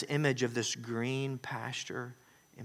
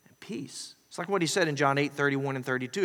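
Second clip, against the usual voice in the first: normal talking voice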